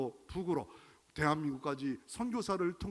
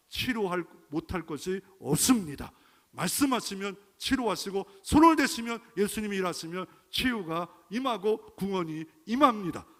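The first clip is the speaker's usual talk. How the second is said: loud, fervent preacher's declaration; full-force preaching; 4 semitones higher, 7 dB louder than usual. Acted. Preacher declaring healing to the congregation, loud. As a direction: declare it with rising power.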